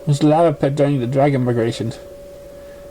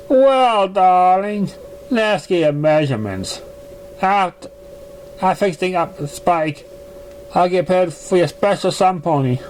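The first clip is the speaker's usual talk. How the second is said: a refined mare, gently defensive; Rarity-like lady; 4 semitones higher than usual. Acted. Narrator voices the same lady.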